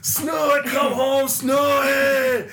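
loud, high shout into the night street; Scottish owner bellowing for his lost dog; drunken Scottish yell. Retold or imitated. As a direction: call the dog home like a drunk Scot. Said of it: "would go out ... and yell".

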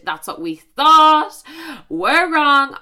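loud, high, slow exasperation; exasperated outburst. Real, not acted. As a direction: fling it out, fed up. Mock outrage at men.

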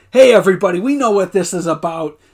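loud mock rallying announcement, building at the end; rally the whole room; imitated rallying speech. Kevin rallies the room, imitated.

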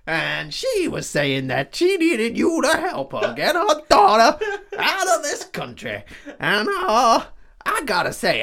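high, slow Southern drawl with laughs breaking in; southern accent. Retold or imitated. Retelling the villain's offer to Wolverine in a high Southern drawl. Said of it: "I missed the accent".

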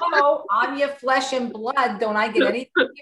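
hammed-up wounded complaint, close to laughing; mock indignation. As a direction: play up being the slighted daughter. Mock complaint at mother's greeting.

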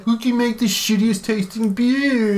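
high, drawn-out mock boast; mocking West Coast brewers. Co-host mimicking West Coast brewers' bitter beer contest, mocking. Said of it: "West Coast is like".

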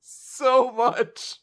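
very slow, drawn-out whine while laughing. Real, not acted. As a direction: stretch each word, half laughing, half wailing. Mocking loser again.

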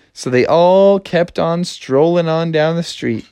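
ordinary delivery

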